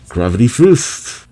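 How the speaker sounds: high, loud, slow attack name; drawn-out special-move yell; attack shout. Acted. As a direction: roar out the move as you strike.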